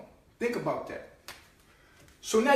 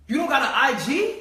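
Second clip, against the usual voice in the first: incredulous mocking question, pitch up and louder; mock disbelief; 5 semitones higher, 4 dB louder than usual. Mocking a friend who has no IG, incredulous. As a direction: scoff at someone who has no IG.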